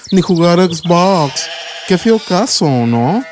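lightly voiced teasing children; a touch of a jeering kid; mocking kids. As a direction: neighbour kids making fun of David. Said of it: "niños ... decían".

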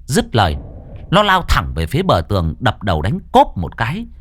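steady storyteller reading a ghost tale; story narration. A narrator's reading. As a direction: tell it like a spooky radio story. Reads horror story narration. (giọng kể chuyện ma)

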